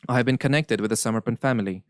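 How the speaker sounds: ordinary delivery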